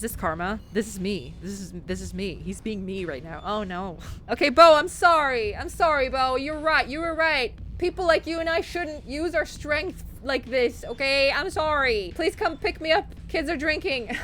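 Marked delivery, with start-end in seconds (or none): 4.3-14.2 higher, loud pleading apology; mock pleading call. Player voicing a sorry kid calling for a ride, pleading. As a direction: beg someone on the phone.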